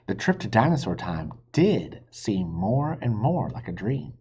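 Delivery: plain reading voice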